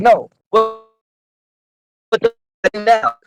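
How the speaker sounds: exasperated, high-pitched challenge; retold spazzing. Snapping back at two housemates' innocent look, exasperated. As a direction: act out being fed up, pitch jumping up. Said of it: "after spazzing on them".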